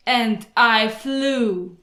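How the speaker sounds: slow, drawn-out triumph; slow triumphant. Acted. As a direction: savour each word slowly. Triumphant story climax.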